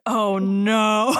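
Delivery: loud, mock-horrified cry; dismayed reaction. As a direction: groan it out in mock dread. Narrator retells reacting to the script.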